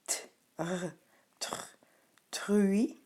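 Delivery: "t, r, tr" as bare consonants building to "truie"; sounding out. Shown as a demonstration. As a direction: blend t and r step by step into "truie". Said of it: "Ici, le E reste muet".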